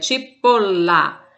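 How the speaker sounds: "cipolla" slowly, the L held long; double consonant shown. Shown as a demonstration. Teacher showing students a doppia, drawn-out double L in "cipolla". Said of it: "é, uma doppia".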